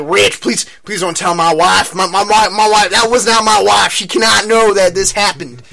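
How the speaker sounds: high, loud, pleading Southern twang; Southern accent. Retold or imitated. Chuck begging Rich to keep it from his wife, pleading Southern accent. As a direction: beg like a panicked Southern guy, imitated. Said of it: "He's a Southern guy".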